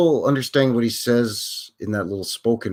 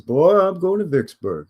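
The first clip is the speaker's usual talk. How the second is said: mock singer's drawl; 7 semitones higher than usual. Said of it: "that's where he says".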